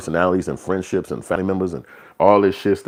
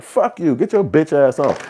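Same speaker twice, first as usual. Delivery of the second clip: higher-pitched mock dismissal; quick call-out; 8 semitones higher than usual. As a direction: send them off in a raised voice. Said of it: "quick to call it out".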